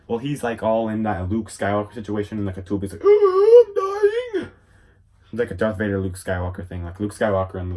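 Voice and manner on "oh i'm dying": very high, crying villain wail; hammed-up dying wail. Villain in the tube, wailing he's dying.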